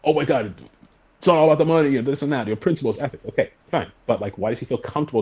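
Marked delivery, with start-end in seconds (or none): 0.0-3.2 high, loud mocking take on a protester; mock indignant protest. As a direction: scoff at the moral outrage. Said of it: "you could say, like".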